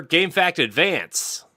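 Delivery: high, drawn-out segment call; segment announcer. Retold or imitated. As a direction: announce it slowly with showy high pitch. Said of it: "How does, how does that usually say it?".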